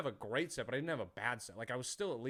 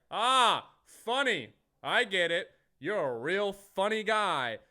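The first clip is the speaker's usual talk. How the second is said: loud, raised, drawn-out sarcasm; mocking heckler; 5 semitones higher, 9 dB louder, slower than usual. Guy in front interrupts comic's setup, loud sarcastic jeer. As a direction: imitate a heckler jeering slowly.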